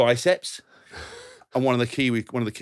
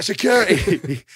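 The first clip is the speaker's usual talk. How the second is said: called out; 8 semitones higher, 5 dB louder, slower than usual. Retold or imitated. Calling out for useless security.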